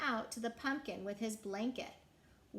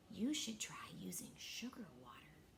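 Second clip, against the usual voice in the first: breathy secret whisper, much softer; 3 semitones lower, 10 dB quieter than usual. A narrator's reading. Morris passing a secret to his brother, whispered. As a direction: whisper it right into his ear. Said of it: "Morris whispered in his ear".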